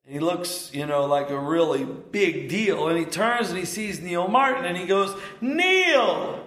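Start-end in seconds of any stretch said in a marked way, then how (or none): 5.4-6.4 a high, ringing call of a name. Retold or imitated. Lord calls to Neil Martin across the tour, calling out. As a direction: hail him from across the hall.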